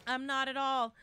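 ordinary delivery

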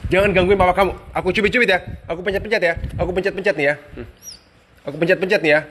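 high, loud mock scolding of the pups; loud teasing scold of naughty puppies; playful threat. Real, not acted.

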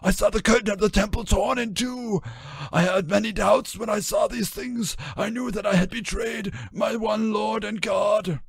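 high, ragged, pleading voice; high anguished testimony; stammering Judas. A narrator's reading. Judas taken aback, explaining to the voice, high and ragged. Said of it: "He stammered".

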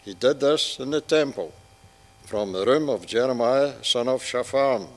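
ordinary talk

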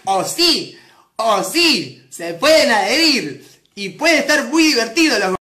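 loud, slow news bulletin; hammed-up news presenter, spaced phrases; newsreader voice. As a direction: declaim it like a TV newscaster. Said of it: "ponen otra voz".

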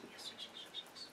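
breathy hushed whisper; barely-there soothing whisper. Real, not acted. Whispering to the possum as she eats.

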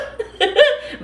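short high real laugh. Real, not acted. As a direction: break into a quick high laugh. Laughing while talking to viewers.

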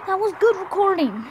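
loud, high, exasperated complaint; loud frustrated lament. Real, not acted. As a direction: wail it at the lost recording. Upset the recording is lost.

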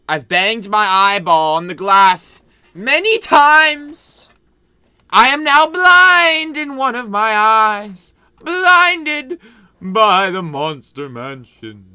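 loud, slow, high lament; dramatic lament. Acted. Agent Tim laments his eye injury, loud and slow. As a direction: declare your blindness grandly.

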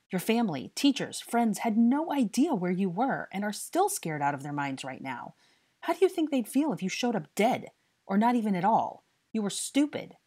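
loud, high-pitched tirade; angry scolding. A narrator's reading. Harry scolds the boy he tracked down, furious. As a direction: lay into him with mounting exasperation.

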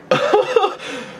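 high, breaking-out giggle of relief; giddy laugh. Real, not acted. Freaking out after the win, giddy high laugh. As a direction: laugh high in real excitement.

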